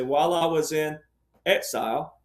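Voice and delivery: pause, then lean on exile; "While I was in ... exile" with a held pause before "exile". Retold or imitated. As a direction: hold a beat before "exile" and set it apart. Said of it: "he pauses".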